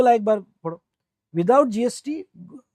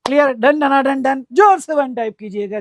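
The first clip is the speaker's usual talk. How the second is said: louder, higher call for responses; rallying call; 4 semitones higher, 5 dB louder than usual. Real, not acted. Teacher urging students to type done, loud and high. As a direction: rouse the class to answer.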